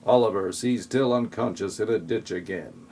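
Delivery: ordinary talk, nothing marked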